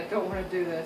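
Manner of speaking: low, soft dread; quiet reluctance. Real, not acted. Repeats the dread under breath.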